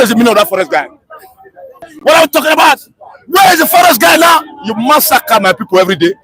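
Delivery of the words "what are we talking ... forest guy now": angry demand. Real, not acted. Caller furious at president.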